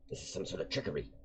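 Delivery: put-on quick, suspicious old man's voice; fast paranoid accusation. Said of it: "he goes".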